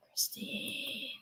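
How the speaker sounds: a ghostly whisper calling Christine; whispered name. Pretend. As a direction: whisper it, no voice at all. Calling the name in varied ways.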